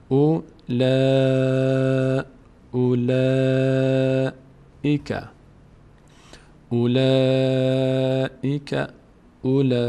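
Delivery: short او, then a very long held "laaa" in اولئک; slow recitation of اولئک with the middle vowel extended; prolonged madd. Shown as a demonstration. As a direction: stretch the alef of اولئک on one steady chanted note. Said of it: "در هنگام تلفظ الف باید مد کنیم".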